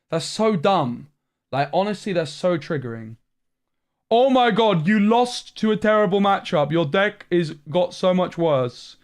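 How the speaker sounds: loud, high-pitched exasperated rant; triggered rant. Real, not acted. Streamer ranting at chat after losing to Diablo, furious. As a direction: let it boil over, voice climbing. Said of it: "I got triggered".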